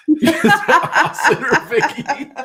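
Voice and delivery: laughing, high and slow; teasing question about who watches, laughing. Real, not acted. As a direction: ask it through laughter.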